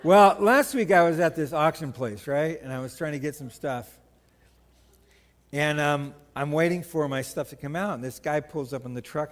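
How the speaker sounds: ordinary talk, nothing marked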